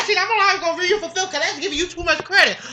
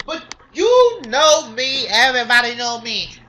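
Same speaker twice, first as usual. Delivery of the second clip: ordinary talk, nothing marked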